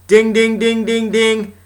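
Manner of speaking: high mock alarm dings; alarm bell ding. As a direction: ring it out like an alarm going off. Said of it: "you know, siren to go off?".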